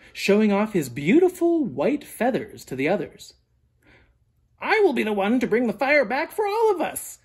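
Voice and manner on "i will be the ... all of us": higher, showy bird voice; proud Raven proclaiming. A narrator's reading. Raven boasting to the animals, high proud voice. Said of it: "he proclaimed".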